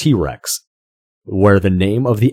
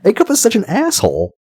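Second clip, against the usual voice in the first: incredulous; 3 dB louder than usual. Real, not acted. Disbelief at casting pick.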